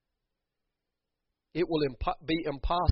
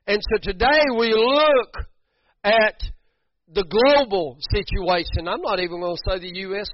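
loud, high preacher's cry; full-force preaching; 7 semitones higher, 10 dB louder than usual. Acted. Preacher rallying the church about the fifty men, loud. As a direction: preach it out with fire.

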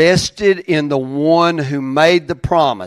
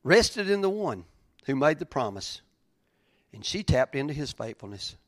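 hushed reflective repeat; 2 semitones lower, 12 dB quieter than usual. Acted. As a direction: let the verse settle quietly, with pauses.

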